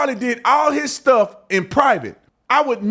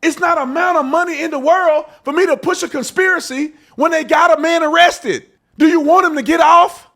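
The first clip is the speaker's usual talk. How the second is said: outraged shout; 6 semitones higher than usual. Real, not acted. Host shouting down people cashing in, outraged. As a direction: yell it at the people using his name.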